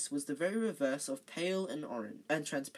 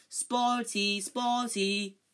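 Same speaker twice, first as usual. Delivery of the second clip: yelling taunt; 7 semitones higher, 6 dB louder, much slower than usual. A narrator's reading.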